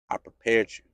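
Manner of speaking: very low, soft fatherly voice of God; quiet reassurance. Acted. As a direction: reassure a hurting son in hushed tones. God calmly answers the protest.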